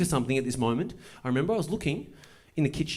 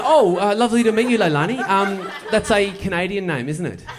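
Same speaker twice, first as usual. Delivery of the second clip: louder, higher nervous excitement; flustered greeting; 6 semitones higher, 6 dB louder than usual. Retold or imitated. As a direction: gush nervously with a laugh.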